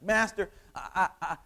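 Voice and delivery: acted apologetic stammer; flustered man stammering to his master; stammering apology. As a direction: stumble haltingly over the first words. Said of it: "he starts stammering apologetically".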